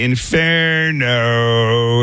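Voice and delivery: drawn-out Inferno, angry and hyped; slow, stretched angry Inferno; hyped angry yell. Shown as a demonstration. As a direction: yell the show name like you're fed up. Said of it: "as loud and angry as you can, like, hyped up".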